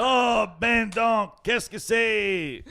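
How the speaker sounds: slow, high, laughing Québécois French; mock Quebec French. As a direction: stretch it out like a puzzled Quebecer, through laughter. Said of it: "and they're like".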